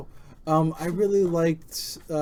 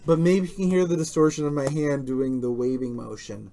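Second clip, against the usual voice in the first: ordinary talk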